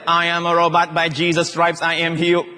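even robot monotone. Acted. Preacher mocking mechanical confession, as a robot. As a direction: chant it on one note like a machine. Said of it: "so you're like".

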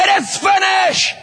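high, slow, shouted cry. Acted. Jesus on the cross, a high slow shouted cry. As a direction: cry it out from the cross, stretched long. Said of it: "he cries out".

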